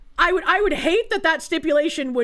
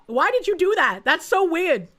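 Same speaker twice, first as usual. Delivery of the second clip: ordinary delivery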